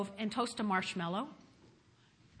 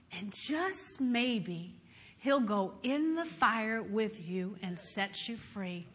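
normal talking voice

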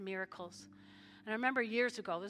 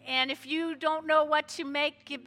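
louder, higher announcements; 6 semitones higher, 10 dB louder than usual. Real, not acted. Pastor calling out potluck news to the standing church, loud. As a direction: call it out over the room.

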